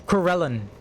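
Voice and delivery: normal talking voice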